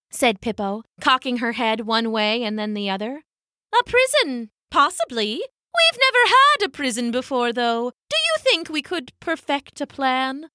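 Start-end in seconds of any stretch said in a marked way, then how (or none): 3.8-10.5 put-on high, prim old penguin hen; a lightly voiced fussy elder. Elder female penguin scolding the thief, high and prim. Pippo considering.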